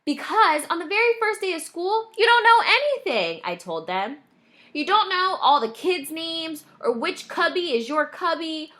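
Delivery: put-on high, insistent little kid; a small boy listing his worries; child's voice. A narrator's reading. Child explains to parents, higher voice.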